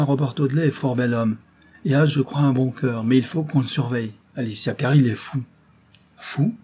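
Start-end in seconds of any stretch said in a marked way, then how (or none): 6.2-6.5 high, sharply rising question; indignant exclamation. A narrator's reading. Young woman hearing her cousin called mad, indignant. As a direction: throw the word back in indignation. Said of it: "S'écria miss Audley avec indignation".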